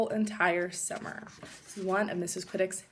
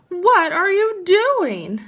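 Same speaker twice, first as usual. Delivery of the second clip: higher, louder, slow dismayed question; alarmed cry; 10 semitones higher, 12 dB louder, slower than usual. Acted. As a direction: sister upset her snacks are taken. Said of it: "cried Sister Bear".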